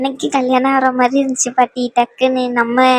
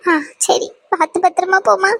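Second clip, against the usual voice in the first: tearful send-off; 5 semitones higher than usual. Acted.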